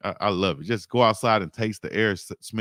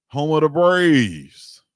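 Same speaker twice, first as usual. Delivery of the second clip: announcer call; 5 semitones higher, 7 dB louder, much slower than usual. Acted. Hailing Alcorn State legend.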